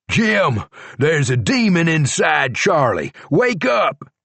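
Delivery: mild read-aloud shout. A narrator's reading. George yelling for help.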